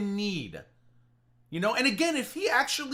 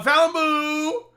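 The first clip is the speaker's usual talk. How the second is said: hammed-up singsong holler to a sweetheart; mock calling out; 8 semitones higher, 7 dB louder than usual. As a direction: call out the pet name, mockingly.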